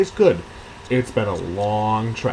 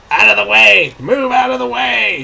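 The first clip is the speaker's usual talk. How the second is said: loud, high bellowing dwarf; hammed-up dwarf yelling over a keg; gruff dwarf shout; 10 semitones higher, 8 dB louder than usual. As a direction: clear the crowd with a bellow. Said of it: "he grumbled".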